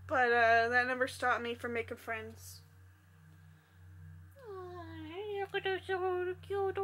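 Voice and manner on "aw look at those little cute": cooing. Real, not acted. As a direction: melt over something cute. Cooing over a toy.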